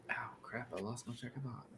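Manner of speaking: whispered curse, under the breath; muttered under breath. Real, not acted. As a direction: mutter it to yourself, barely voiced. Lost his train of thought.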